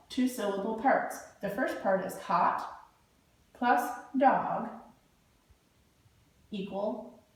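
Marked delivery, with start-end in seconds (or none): none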